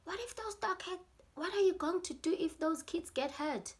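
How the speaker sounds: high, loud retold scolding; imitated shouting dad. Dad to his sons after the dogs, shouting. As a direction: fire off outraged questions in an imitated high yell. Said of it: "his dad was shouting".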